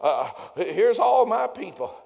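mock God sighing over his broke people; mock pitying God. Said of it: "You think God looks down at us and says".

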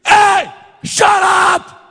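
high, loud, very slow rebuke; furious shout. Acted. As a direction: roar it at the storm. Jesus rebukes the storm.

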